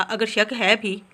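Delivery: drawn-out, teasing pace; slow, teasing hint of a secret. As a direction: stretch the words slowly, playfully.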